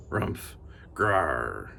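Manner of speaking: low muttering bear growl; a soft low animal grumble; growled bear grunt. Acted. Bear growling at the candle lighting, low grumble. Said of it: "muttered and growled".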